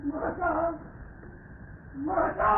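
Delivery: very high, desperate calls; anguished cry. Acted. Bereft father crying out to the Mother, anguished. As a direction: cry out to the goddess in despair.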